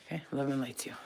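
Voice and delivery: soft, sleepy whisper; tired whisper. Real, not acted. Signing off to viewers while tired, a soft whisper. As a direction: hush it like you're fading off. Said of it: "I'm getting tired".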